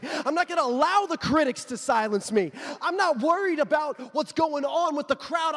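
rally the congregation, growing loud; building preaching. Acted. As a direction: preach with rising force.

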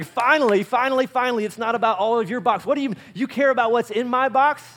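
high, loud excited outburst from an exasperated wife. Acted. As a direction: imitate a wife who is thrilled at last. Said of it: "she's gonna be like".

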